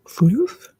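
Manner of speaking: voiced floof. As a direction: pop floof out in full voice. Playing with the word floof.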